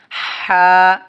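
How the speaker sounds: হা called out loud; emphatic ha. Shown as a demonstration. Teacher sounding হা for students to repeat. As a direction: sing out হা clearly. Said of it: "কি হবে?".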